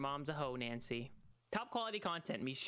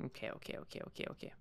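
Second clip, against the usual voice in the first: breathless whispered okays; nervous whispered okays; 5 dB quieter, faster than usual. Real, not acted. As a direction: hush yourself down in fear. Whispering while scared.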